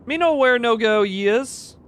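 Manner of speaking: put-on high, loud baby-talk voice; mock simpleton. Lost player joking about having nowhere to go, high simpleton voice. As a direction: play dumb in broken baby talk.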